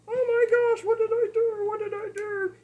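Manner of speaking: high, loud panicked cry; a frightened dog wailing, high. Retold or imitated. Scared dog after a grouchy owner, high panicked wail. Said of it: "he's like".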